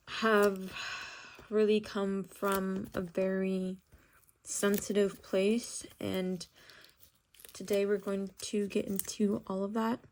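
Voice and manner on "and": ordinary talk, nothing marked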